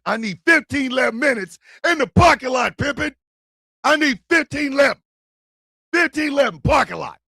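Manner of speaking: loud challenge, called out across a lot; calling him out. Pretend. Comedian calling out the rival, loud and daring. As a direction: summon him to the parking lot.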